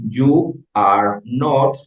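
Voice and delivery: You are not drawn out very slowly; very slow model. Shown as a demonstration. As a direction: spell out the structure slowly. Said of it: "en la estructura oficial del inglés". (muy despacio, como modelo)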